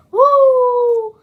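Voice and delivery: high, loud wrestler woo; Ric Flair woo. Retold or imitated.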